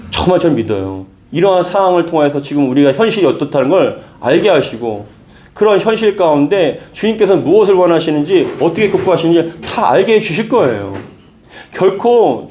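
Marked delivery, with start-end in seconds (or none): none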